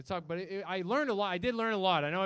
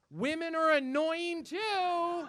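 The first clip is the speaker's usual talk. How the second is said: very slow, higher, drawn-out grumble; sulky heart; 10 semitones higher, much slower than usual. Retold or imitated. The comic's heart grumbling back, slow and drawn out. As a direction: stretch it out like a sulky child. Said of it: "my heart is like".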